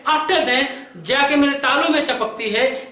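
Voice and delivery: ordinary talk, nothing marked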